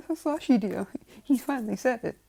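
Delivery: high, loud, emotional outburst; overjoyed mother. Acted. Mother running to tell husband, overjoyed. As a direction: cry out the happy news in a put-on high voice.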